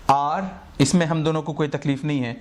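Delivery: normal talking voice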